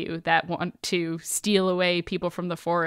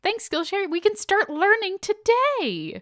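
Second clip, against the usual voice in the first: much higher, sing-song and slow; high chirpy voice; 13 semitones higher than usual. Acted. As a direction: squeak it out like a cheerful mascot. Echoing sponsor thanks high.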